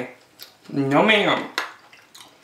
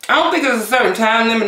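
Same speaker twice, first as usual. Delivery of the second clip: normal talking voice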